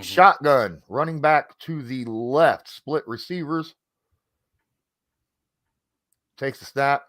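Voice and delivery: play-by-play. Acted. Calls the play live.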